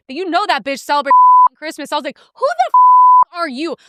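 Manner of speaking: high, loud furious tirade; angry rant. Retold or imitated. Comic raging at a rich couple, high and loud. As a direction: tear into them at full steam. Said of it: "It made me so f-ing angry".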